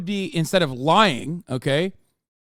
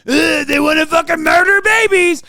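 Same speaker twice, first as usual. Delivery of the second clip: loud, high, dumb sneering caricature voice; mocking Republican; 9 semitones higher, 9 dB louder than usual. Streamer mocking Republicans' outrage in a shouted dumb voice. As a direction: ham up a brainless outraged Republican. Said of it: "get away with being like".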